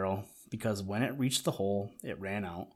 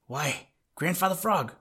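rising high and loud in alarm; startled, worried cry to the old frog; a sudden high cry of dismay; 9 semitones higher, 5 dB louder than usual. A narrator's reading. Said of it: "they cried".

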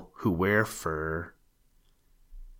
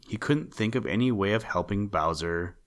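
usual speaking voice

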